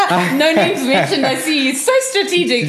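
high, laughing teasing voice; laughing tease. Real, not acted. Host teasing guest about his old job, laughing. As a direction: giggle through the jab.

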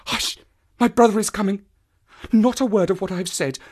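high, breathy conspiratorial woman; urgent hushing. A narrator's reading. Woman warns as her brother nears, urgent. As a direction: hush him, then hurried warning.

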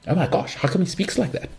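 puzzled, high-pitched imitation of a listener; baffled listener. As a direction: play a listener taken aback. Voicing a puzzled podcast listener.

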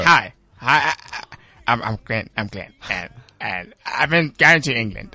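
hesitant, stammering mock imitation; Glenn. Comedian teases fellow guest by introducing himself as them, halting imitation. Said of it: "That's exactly what you sound like".

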